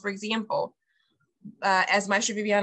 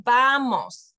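"vamos" drawn out, stressed on VA; teacher stressing the first syllable of "vamos"; 3 semitones higher, 5 dB louder than usual. Shown as a demonstration. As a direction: lean slowly on the VA in "vamos". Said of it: "that's where you put the emphasis".

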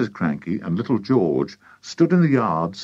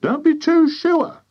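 very high, louder retort; doubting farmer's wife; 5 dB louder than usual. A narrator's reading. Wife dampening her husband's hopes, high sharp retort. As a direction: sceptical high-voiced wife.

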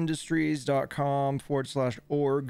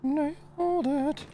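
much higher, slow singsong drawl; high, slow sing-song; much slower than usual. Acted. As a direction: stretch it out up high, gloating. Drawn-out repeat of same words.

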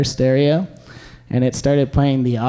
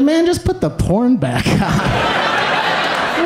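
mock exasperation; 9 semitones higher, much slower than usual. Joking to flustered friend in car.

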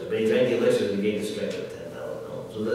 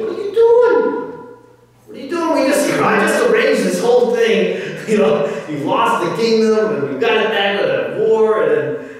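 high, loud exasperated rant; freaking out; 9 semitones higher, 7 dB louder than usual. Retold or imitated. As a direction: imitate Krishna berating a king, incredulous and forceful. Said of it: "Krishna's freaking out".

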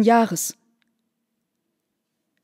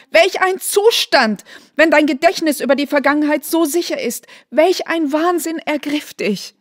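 agitated outcry; 6 semitones higher than usual. A narrator's reading. Mother reproaches her daughter, agitated and high. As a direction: scold her in rising alarm. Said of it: "rief die Mutter wieder".

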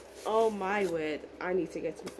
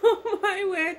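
much higher, louder, laughing through it; 10 semitones higher, 5 dB louder than usual. Real, not acted. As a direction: gush while laughing. Laughing at gift.

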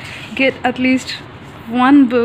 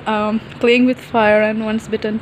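usual speaking voice

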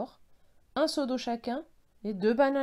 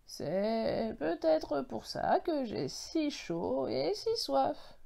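slow, higher-pitched groaning tree voice; hoarse baobab; 5 semitones higher, much slower than usual. A narrator's reading. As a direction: drag the words out like a parched old tree. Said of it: "a gémi la voix enrouée".

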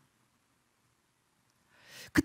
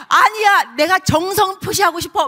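insistent call; 8 semitones higher, 10 dB louder than usual. Pretend. God voiced insisting on giving.

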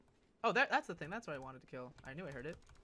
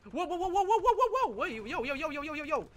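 rapid high excited whoas; excited yelling; 11 semitones higher, 7 dB louder than usual. Real, not acted. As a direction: yell in sudden alarm. Yelling in sudden game panic.

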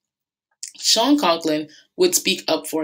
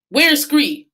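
demanding officer; 10 semitones higher, 6 dB louder than usual. Acted.

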